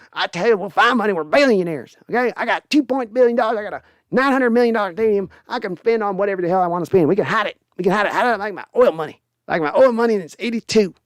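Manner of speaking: put-on high, fast, blustering old owner; Jerry impression. Streamer doing the team owner for chat, high and blustery. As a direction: brag like a reckless billionaire dad. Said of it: "I'll do some more Jerry and Steven".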